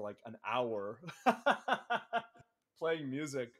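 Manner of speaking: ordinary delivery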